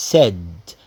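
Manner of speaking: said pronounced sed, low and clipped off; teacher models said as sed. Shown as a demonstration. يشرح للطلاب نطق said: sed. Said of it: "شوفوا نطقها ازاي".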